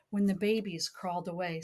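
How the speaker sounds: lilting read-aloud storybook voice; sing-song picture book reading; reading to a child. Acted.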